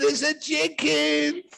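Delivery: high, slow mock wife voice; hammed-up wife, drawn out and high; insistent wife.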